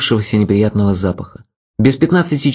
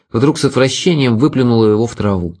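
ordinary delivery, nothing marked

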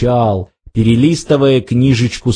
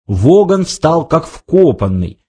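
ordinary delivery, nothing marked

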